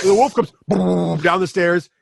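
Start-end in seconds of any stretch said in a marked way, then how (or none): 0.7-1.2 rolling mouth-made boom; imitated tumbling boom. Game master imitates a wolf tumbling downstairs, booming mouth noise. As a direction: make the sound of a heavy tumble.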